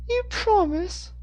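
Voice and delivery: put-on very high, slow child question; little boy. A narrator's reading. Wary boy to a man offering food, little boy. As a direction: ask it slowly in a small boy's high pitch. Boy asks Moore for reassurance.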